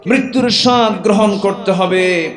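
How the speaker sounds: high-pitched preacher's cry; fiery preaching. Acted. Preacher warning the crowd of death, high and urgent. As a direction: lift the voice and preach it out.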